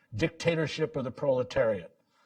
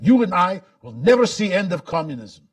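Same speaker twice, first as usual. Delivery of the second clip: higher, louder imitation of a Russian accent; mock Russian accent, raised and emphatic; thick Russian accent; 6 semitones higher, 7 dB louder than usual. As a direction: urge it on like a stirred-up Russian exile. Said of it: "in his thick Russian accent".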